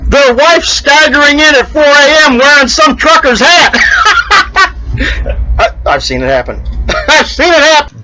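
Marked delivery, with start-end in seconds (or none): 0.1-3.8 laughing. Real, not acted. Joking punchline about the wife. 3.8-5.5 airy high-pitched laugh; cracking up at own joke, breathy and high; wheezy giggle at the punchline. Real, not acted. 7.1-8.0 laughing as you repeat it. Real, not acted.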